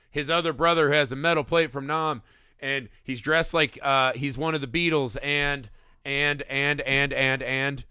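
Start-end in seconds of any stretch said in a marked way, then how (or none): none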